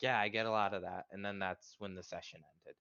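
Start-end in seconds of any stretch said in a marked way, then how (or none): 0.0-1.1 sarcastic quip. Retold or imitated. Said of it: "And I'm like".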